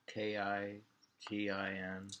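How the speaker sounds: usual speaking voice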